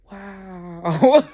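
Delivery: deadpan wow. Retold or imitated. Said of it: "I would just be like".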